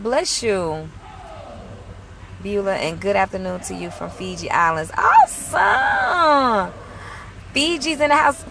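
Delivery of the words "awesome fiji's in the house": high, stretched-out awesome; drawn-out delighted exclaim; excited awesome. Real, not acted. Reacting to a viewer from Fiji, stretched excited exclaim.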